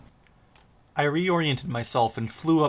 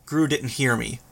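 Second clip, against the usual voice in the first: ordinary delivery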